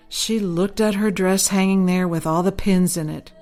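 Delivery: ordinary delivery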